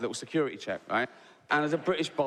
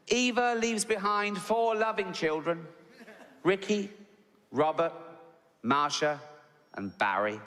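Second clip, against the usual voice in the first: a vicar's slow, solemn eulogy voice, each name spaced out; mock vicar; 4 semitones higher than usual. Vicar reading the eulogy names to the packed church, solemn and slow. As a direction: intone it from the pulpit, reading from notes. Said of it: "And he goes".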